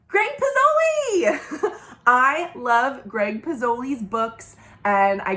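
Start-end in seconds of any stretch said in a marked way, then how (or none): 0.1-1.7 big high drawn-out announcement; drumroll reveal. Acted. Librarian announcing her favorite author, big excited reveal. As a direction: unveil the name like a prize.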